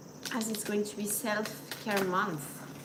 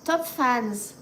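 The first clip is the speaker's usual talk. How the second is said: normal talking voice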